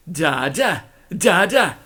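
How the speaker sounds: childish mocking dada, rising then falling. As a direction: taunt him like a baby. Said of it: "they mocked".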